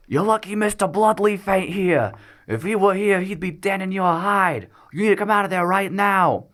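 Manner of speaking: high-pitched, loud gnome shout; gnome yelling. A narrator's reading. Gnome boss yelling at people holed up in another room, high angry shout. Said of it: "the gnome yells".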